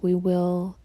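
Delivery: ordinary talk, nothing marked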